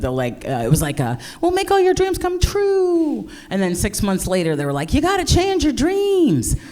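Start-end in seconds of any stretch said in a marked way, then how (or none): 1.4-3.3 high, drawn-out dreamy sales pitch; advertising voice. Retold or imitated. Matchmaking service promising dreams to clients, high ad voice. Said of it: "Their advertising was, like, really good". 4.9-6.6 high, drawn-out echo of a sales pitch; mock advertising voice. Matchmaking service to client six months later, mock ad voice.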